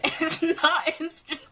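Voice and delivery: words broken by real giggles; laughing through words. Real, not acted. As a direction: try to say it while cracking up. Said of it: "I can't even talk".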